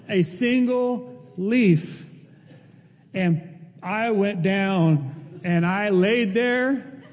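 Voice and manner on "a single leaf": slow comic emphasis. Real, not acted. Punchline of the back story.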